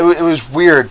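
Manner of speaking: usual speaking voice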